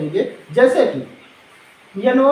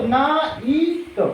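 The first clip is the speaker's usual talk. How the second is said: very slow, blending ना and ईट piece by piece; slow sounding out; 3 semitones higher, much slower than usual. Shown as a demonstration. Teacher slowly blends the sounds for her pupils. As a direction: stretch each sound so the children can follow. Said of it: "आई को हम ई पढ़ने वाले हैं".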